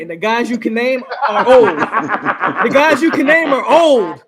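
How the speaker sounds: can't keep a straight face while saying it; laughing. Real, not acted. Laughing while making the point.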